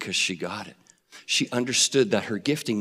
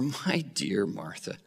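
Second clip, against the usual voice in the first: tender; 5 semitones lower, 9 dB quieter, slower than usual. Retold or imitated. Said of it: "I love the tone of voice".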